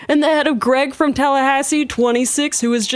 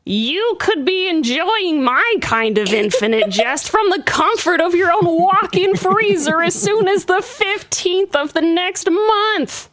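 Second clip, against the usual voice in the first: Yorick voice; 7 semitones higher than usual. Acted. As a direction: speak for the skull. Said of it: "Just ask my new friend Yorick here".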